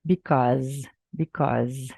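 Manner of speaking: "because" said slowly as bee-KAWZ, stress on the second syllable; because pronunciation demo. Shown as a demonstration. Teacher models "because" for Brazilian students, careful demo. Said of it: "A pronúncia desse because é mais ou menos assim".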